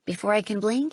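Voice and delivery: plain reading voice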